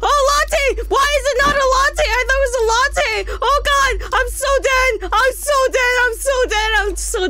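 high-pitched shrieking meltdown; very high, frantic wailing; panicked screaming. Pretend. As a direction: scream in hammed-up terror. Screaming over failed latte order.

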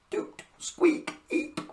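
breathy squeaky toot sounds; silly fart noises. Acted. Imitating little fart noises.

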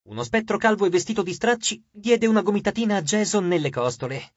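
ordinary delivery

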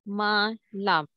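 semalam split into se, ma, lam with gaps; syllable by syllable. Shown as a demonstration. Teacher breaking down the word for students, semalam in separate syllables. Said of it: "Se ma, and the next syllable, lam".

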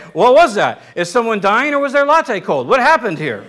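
loud startled diners, hammed up; mock alarm. Restaurant diners react to a scream.

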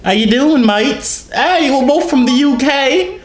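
loud, high fake British accent; mock British accent. Greeting British callers as a fellow Brit, mock British accent. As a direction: put on a slow, hammed-up British greeting. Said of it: "tries to do a British accent".

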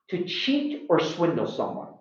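ordinary delivery

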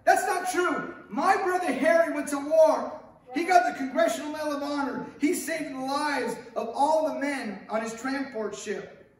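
loud, high, outraged shout of denial; George's furious outburst. Acted. George shouting at Clarence that it's a lie, loud and high. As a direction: yell it like a man refusing the truth. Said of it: "And George goes".

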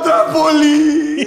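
high, drawn-out heckler's yell; imitation of a drunk fan calling out; mock drunken shout. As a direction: holler the request like a sozzled uncle. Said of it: "Estaba zampado ya".

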